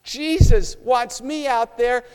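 a touch of panicked fretting; anxious, wringing hands. Acted. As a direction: say it out loud in nervous dismay. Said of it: "You begin to say out loud, wring in your hands".

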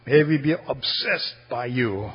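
soft, low, measured praying voice; closing prayer. Real, not acted. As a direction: pray gently, pausing between phrases, sinking to amen. Leads congregation in closing prayer.

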